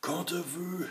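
put-on high, loud boy's command; James commanding. A narrator's reading. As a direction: take charge of the others. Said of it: "dit-il au autre".

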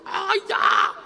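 sudden high shout of amazement; high startled cry; amazed cry. Acted. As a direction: yelp in disbelief. Amazed at endless dentures.

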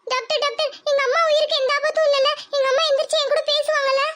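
put-on high child voice begging the doctor; desperate little girl's plea; pleading girl. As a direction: beg him to save her mother. Girl asks if mother lives.

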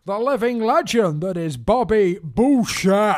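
slow, loud dramatic announcer; X Factor voiceover. Acted. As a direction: build it like a TV show intro. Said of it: "X Factor voiceover man".

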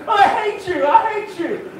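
hammed-up bawling kid; a little boy screaming at his dad's idea; crying little boy. Crying boys at hardware store.

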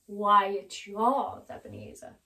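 spirit laughing. Acted. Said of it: "the spirit laughed".

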